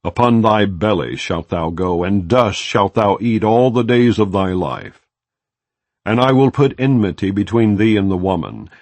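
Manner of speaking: ordinary delivery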